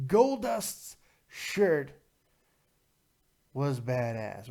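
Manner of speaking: very slow, with a long pause before BADASS; slow emphatic repeat. Real, not acted. Repeats phrase slowly for emphasis.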